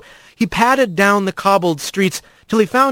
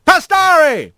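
high, drawn-out holler; straw boss yell; 10 semitones higher, 3 dB louder than usual. A narrator's reading. Straw boss calling out the slacking new hauler, yelling. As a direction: bellow the surname, pitched way up. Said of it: "he heard the straw boss yell".

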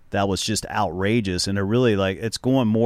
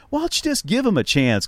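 a fan's mocking shout, pitched way up; retold heckler yell; 12 semitones higher than usual. Fan heckling Nadal from the crowd, a high mock yell. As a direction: call it out high from the stands like a heckler. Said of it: "one guy just yells out".

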